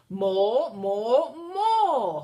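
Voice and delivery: loud, rising dragon holler. A narrator's reading. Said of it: "hollered the dragon".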